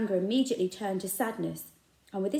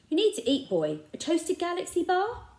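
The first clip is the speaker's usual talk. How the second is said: coaxing shopkeeper; 10 semitones higher, 5 dB louder than usual. A narrator's reading. Raj coaxing.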